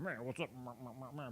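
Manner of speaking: soft, dismissive tough-guy mumble; mock tough guy. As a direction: imitate a lazy, cocky dude. People imitate his supposed attitude.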